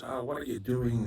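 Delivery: normal talking voice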